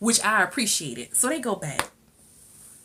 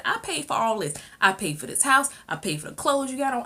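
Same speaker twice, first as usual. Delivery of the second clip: retold indignant rant; 7 semitones higher, 3 dB louder than usual. Acted father's quarrel rant.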